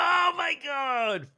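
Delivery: high-pitched thrilled cry, drawn out slowly; excited shriek. Real, not acted. Host reacts with envy to guest's spoilers, a high shriek. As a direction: squeal it in delighted envy.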